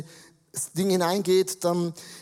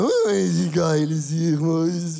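put-on drunk voice; 4 dB louder than usual. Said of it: "ist so ein bisschen mehr so".

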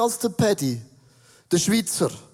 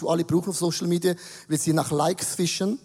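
usual speaking voice

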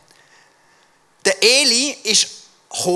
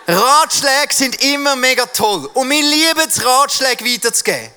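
high, loud, drawn-out mock enthusiasm; big, slow, over-the-top cheer; hammed-up proclamation; 8 semitones higher, 7 dB louder than usual. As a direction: proclaim it with exaggerated zeal. Hammed-up praise of giving advice.

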